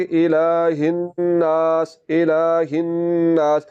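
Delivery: slow "इलाहिन नास" with held n, long aa; ghunna and lengthening. Shown as a demonstration. Teacher showing how to read it, slow drawn-out recitation. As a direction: hum the n, then stretch "नास". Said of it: "नून को आपने लंबा करना है".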